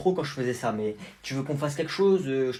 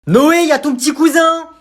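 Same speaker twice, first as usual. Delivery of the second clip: calling out; 13 semitones higher, 16 dB louder than usual. Acted. La tante appelle Noé.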